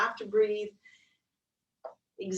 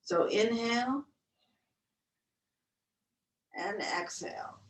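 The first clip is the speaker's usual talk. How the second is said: guided breath count. Acted.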